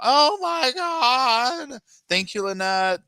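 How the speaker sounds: very high, slow, whiny fake-teen gush; mock teen girl. Mocking the girl chatting with friends, high drawn-out gush. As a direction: ham up a silly girl on the phone. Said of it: "I'm like".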